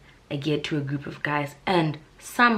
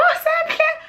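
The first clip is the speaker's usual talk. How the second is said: much higher squealing fan voice; gushing girls; 5 dB louder than usual. Retold or imitated. Girls in the club toilet gushing over her, high squeal. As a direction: squeal like an adoring girl in the toilet. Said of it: "You're like".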